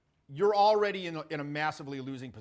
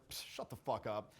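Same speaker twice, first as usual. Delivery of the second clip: lower, softer easy brush-off; dismissive, not angry; 7 semitones lower, 9 dB quieter than usual. Shown as a demonstration. He shuts down an offended complaint, low and calm. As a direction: wave it away quietly. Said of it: "Not angry".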